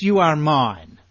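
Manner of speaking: firm declaration. Acted. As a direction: announce it with authority. God's words quoted, said twice.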